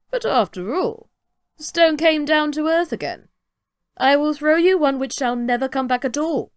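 lightly voiced cocky tailor; boastful little tailor. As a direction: puff yourself up like a tiny braggart. Tailor brags to the giant.

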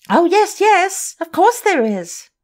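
put-on high, loud panicky girl's voice; frightened cry. Meg Giry cries out to Sorelli, high and panicky. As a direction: squeal in alarm. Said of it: "cried that little dried plum".

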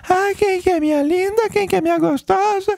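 high imitated baby-talk voice. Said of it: "usando voz de bebezinho ainda".

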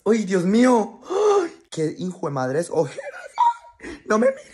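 high, loud shrieks with a laugh; horrified shriek. Real, not acted. At his own tired face, shrieking in horror. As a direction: recoil from the mirror, screaming. Sees his dark circles.

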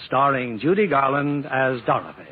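ordinary talk

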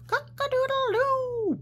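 high crowing rooster. Acted. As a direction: crow it like a rooster. Reading the noise list.

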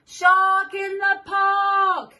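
high, slow shout of the warning; sing-song shouted alarm, slow and high. Acted. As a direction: call it out big for the child. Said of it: "He shouts".

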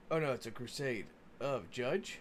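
ordinary talk

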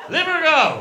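loud, high call to begin. Retold or imitated. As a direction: shout the go signal like a starter.

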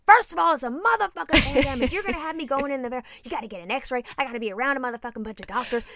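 loud, heated mother's rant; riled-up rant. Retold or imitated. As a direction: tear into your husband. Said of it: "'Cause I was fucking riled up".

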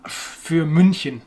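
für München, slowly, with rounded U umlaut; slow demonstration of für München; careful German umlaut. Shown as a demonstration. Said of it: "If you ask me to say für".

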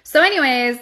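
ordinary delivery

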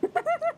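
high, loud nervous shriek of a laugh. Real, not acted. Gamer after a jump scare, shrill nervous laugh.

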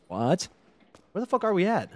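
ordinary talk

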